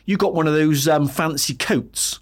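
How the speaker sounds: put-on a young lad from Bristol with a Bristolian accent, pitched high. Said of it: "I'll do my best Bristolian accent".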